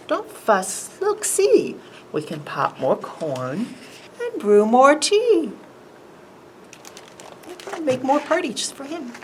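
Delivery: squeaky mouse. Acted. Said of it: "Mouse squeaks".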